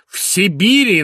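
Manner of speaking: put-on the father beginning his lecture to the boy, imposing. A narrator's reading. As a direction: announce it with gravity. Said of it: "начал он внушительным и важным тоном".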